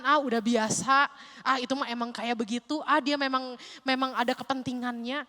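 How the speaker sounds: loud, scornful brush-off; dismissive scoff. Retold or imitated. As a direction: wave it away with contempt. Preacher quotes dismissive believers.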